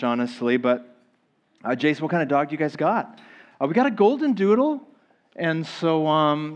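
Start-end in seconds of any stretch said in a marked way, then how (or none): 3.6-4.8 higher, quick reply; mock Q&A reply. Preacher answering his own imagined question about the dog, higher and quick.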